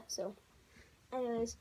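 ordinary talk, nothing marked

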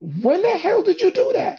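high-pitched incredulous squawk; astonished outcry. Retold or imitated. Amazed crowd asking about his new song, astonished. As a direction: imitate amazed friends, voice shot way up. Retold amazed listeners question him.

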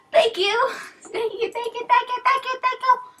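very high, squealed gushing; excited repeated thanks. Real, not acted. Streamer overwhelmed by angel helmets, squealing thanks. Gushing over rare gifts.

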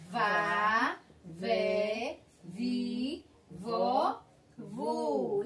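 vá, vé, vi, vó, vu each said separately and clearly; syllable drill. Shown as a demonstration. Teacher reading the V syllables to children, distinct. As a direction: sound out each syllable for the class. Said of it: "Vamos ler a sílaba?".

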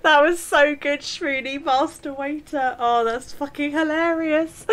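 high voice, still laughing; helpless laughter. Real, not acted. Gamer savouring a daft in-game gag, helpless with laughter. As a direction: squeeze the words out after a laughing fit.

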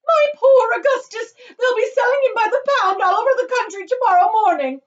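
high, loud hysterical wail; screaming lament. A narrator's reading. Mother mourns son sold as fudge, screaming. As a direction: wail at full voice. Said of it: "screamed Missus Gloop".

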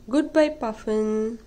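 plain reading voice

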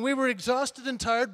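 normal talking voice

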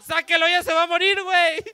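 husband in the delivery room, panicking; panicked plea. Retold or imitated. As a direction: beg the doctor to get it out in a panic. Panicked dad retold.

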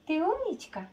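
high sweet praise for the dog; squeaky praising a dog. Real, not acted. Owner praising dog for trick.

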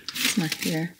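ordinary talk

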